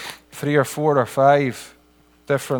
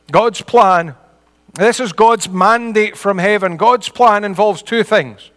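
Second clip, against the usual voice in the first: raised, higher preaching voice; impassioned preaching; 5 semitones higher, 4 dB louder, slower than usual. Real, not acted. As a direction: preach it out to the whole congregation. Preaches the main point.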